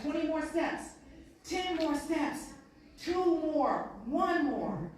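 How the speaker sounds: loud, high, slow counting; countdown shout. Retold or imitated. Coach counts player to the end, loud. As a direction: call out the last steps.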